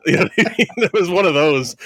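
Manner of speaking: high, laughing through the words; cracking up at his own joke. Real, not acted. Laughing at stink palm joke.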